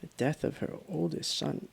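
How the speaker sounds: normal talking voice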